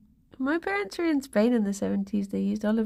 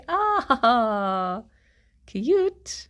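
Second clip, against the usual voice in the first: excited coo; 3 semitones higher than usual. Real, not acted. Cooing at a viewer's cat.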